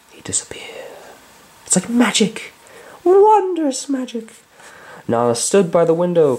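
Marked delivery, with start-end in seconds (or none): 0.1-0.7 hushed storyteller whisper. A narrator's reading. Storyteller whispering to listeners. 1.7-4.3 awed wonder. A narrator's reading. Awed storybook aside.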